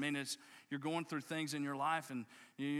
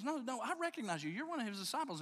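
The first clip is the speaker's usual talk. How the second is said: put-on high-pitched insistent girl; teenage girl; 6 semitones higher, faster than usual. Teenage girl tells Peter she recognizes him, high and insistent. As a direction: play a girl who won't be put off, pitched up. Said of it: "this teenage girl says".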